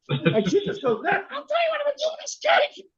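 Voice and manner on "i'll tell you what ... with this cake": very high, menacing mock threat; hammed-up mother about to smash the cake; mother threatening her brother with the cake; angry Italian mother. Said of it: "the angry Italian mother".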